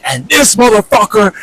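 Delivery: mock his furious outburst; imitating dad's yell. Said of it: "And then he was like".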